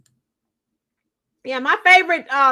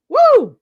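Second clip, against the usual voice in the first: short, high "woo"; loud excited whoop; 9 semitones higher, 9 dB louder than usual. Real, not acted. Livestreamer two likes from one hundred, whooping loudly. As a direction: let out a sudden high cheer.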